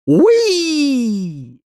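long high "wheee"; high drawn-out whoop; gleeful squeal. Acted. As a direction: squeal as if swinging through air. Acted squeal while swinging on vine.

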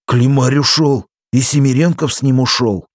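high, loud, urgent report; breathless news. A narrator's reading. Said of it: "запыхавшись, вернулся на панское пепелище".

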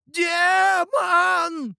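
very high, long-held scream; piercing, drawn-out cry of alarm; old man's continued shouting. A narrator's reading. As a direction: keep screaming it, stretched out high. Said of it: "продолжал кричать старик".

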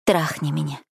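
lightly breathed-out request; a touch of a sighed plea; exhaled. A narrator's reading. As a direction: let it out on a breath, understated. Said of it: "выдохнула я".

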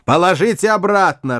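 громкий, резкий срыв на крик; сорвись на крик. Acted. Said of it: "Рэкем опять сорвался на крик". (сорвавшийся крик)

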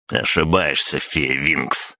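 plain reading voice

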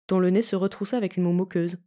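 usual speaking voice

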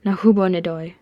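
plain reading voice

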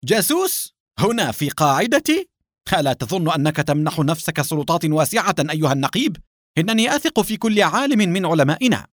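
high, indignant protest; heated cry. A narrator's reading. Base chief defending his scientists, crying out heatedly. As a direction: pitch jumps up in offended disbelief. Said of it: "صرخ الدكتور سامي محتدا".

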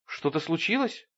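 put-on, mild anxiety; feigned concern. A narrator's reading. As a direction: ask as if worried, insincerely. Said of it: "спросил он с притворной тревогой".

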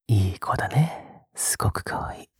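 soft, low, breathy murmur; tender praise. Acted. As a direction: murmur it fondly, almost whispering. Praises listener for eating quietly. (吐息まじりに優しく囁く)

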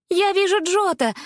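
high, loud cry of discovery; excited exclamation. A narrator's reading. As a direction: shout it out in joy. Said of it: "воскликнула она".

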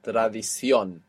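Tradición with the weight on CIÓN; final-syllable stress. Shown as a demonstration. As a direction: land on the last O of Tradición. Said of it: "showing you that you're stressing it".

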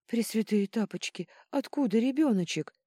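high, loud plea; exhausted pleading. A narrator's reading. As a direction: beg with the last of your strength. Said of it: "из последних сил взмолилась принцесса". (мольба из последних сил)

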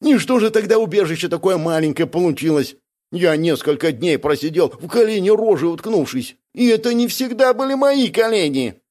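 high, complaining character voice; whiny, aggrieved Бяка. Acted. Бяка blaming Гнусис for the cramped hole, whiny.

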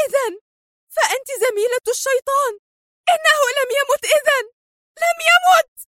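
high, building furious cry; frantic rage. A narrator's reading. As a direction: scream it as if losing your mind. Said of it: "وهي تصرخ في غضب جنوني".

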